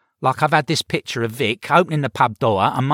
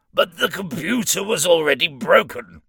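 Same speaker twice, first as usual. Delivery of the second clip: protesting; 8 semitones higher than usual. Acted. Demon protests to the imp.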